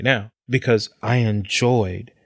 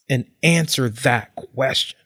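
usual speaking voice

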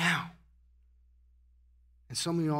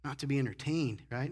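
ordinary delivery

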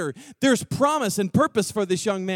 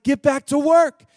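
louder firm command; commanding God; 5 semitones higher, 6 dB louder than usual. Acted. God at the burning bush, loud firm command. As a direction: order him back to work.

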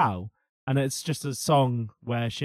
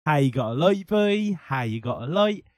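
put-on old Norfolk postman singing; Norfolk accent. Shown as a demonstration. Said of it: "Yeah, I done it".

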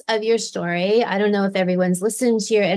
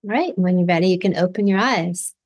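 guided meditation. Acted. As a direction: gently guide listeners through the visualization. Leads a guided visualization.

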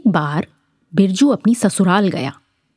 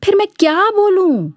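panicked Birju; 11 semitones higher than usual. Acted. Birju, chased by the angry farmer, begs in a high scared voice. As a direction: voice jumps up high in a scared squeak. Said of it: "Birju घबरा कर बोला".

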